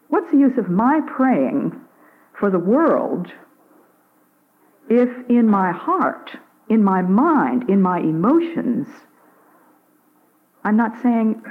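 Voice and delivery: ordinary talk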